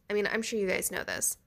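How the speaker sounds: normal talking voice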